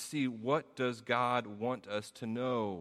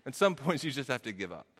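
ordinary delivery

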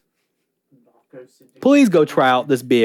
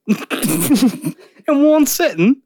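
mock British; 8 semitones higher, much slower than usual.

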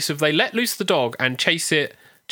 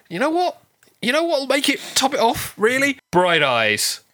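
scheming filmmakers; 9 semitones higher than usual. Retold or imitated. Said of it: "then they went in".

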